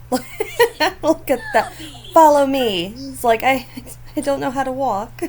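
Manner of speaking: high, giggly voice for a Sim who can't walk; wobbly Sim voice. Pretend. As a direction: speak as a toddler-like Sim learning to walk.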